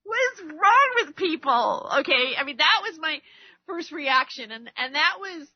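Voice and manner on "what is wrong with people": exasperated shout at people's views; yell. Retold or imitated. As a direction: throw your hands up and shout it. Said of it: "I don't mean to yell right now".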